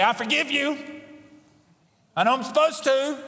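high, put-upon mock voice; grudging forgiveness. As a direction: mimic a reluctant forgiver. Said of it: "He didn't say".

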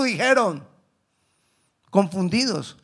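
usual speaking voice

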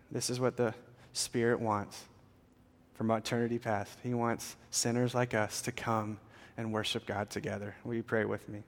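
usual speaking voice

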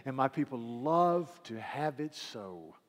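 loud preacher reading scripture; preaching. Acted. Preacher to congregation, proclaiming scripture. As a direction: proclaim it from the pulpit.

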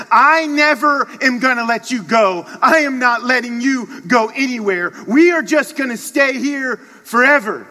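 loud, high, emphatic outpouring; fervent clinging declaration. Acted. Loved one in a big hug, loud fervent vow. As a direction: proclaim it with unshakable devotion, hitting each phrase.

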